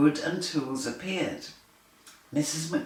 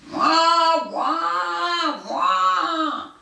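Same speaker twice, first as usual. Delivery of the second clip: high, loud wailing wah; baby crying; 11 semitones higher, 5 dB louder, much slower than usual. A narrator's reading. Reading to children, a loud baby wailing. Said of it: "The baby was very loud too".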